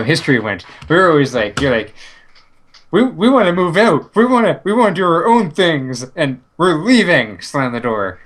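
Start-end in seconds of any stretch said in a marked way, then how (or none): 2.9-7.4 put-on defiant teen. Acting out a country moving out.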